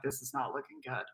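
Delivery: quiet low muttered aside. Retold or imitated. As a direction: say it to yourself, low and soft. Muttered aside at lawyer's office.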